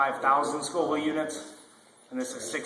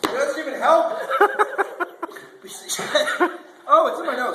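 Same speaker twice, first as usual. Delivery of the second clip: pained laughing; 13 semitones higher than usual. Real, not acted. Burning from hot sauce.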